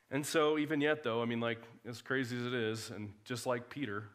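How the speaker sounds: ordinary talk, nothing marked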